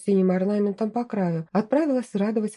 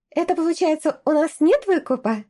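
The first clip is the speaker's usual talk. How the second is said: high, bright gleeful question; delighted exclaim; 7 semitones higher than usual. A narrator's reading. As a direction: squeal it with relief. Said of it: "обрадованно воскликнула Нета".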